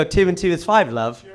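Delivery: a hint of British charm to sell the sum; British accent. Shown as a demonstration. Comic demos how a British accent makes nonsense sound right, in a mild British accent. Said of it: "But if I go".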